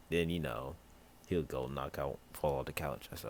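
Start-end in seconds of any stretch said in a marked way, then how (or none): none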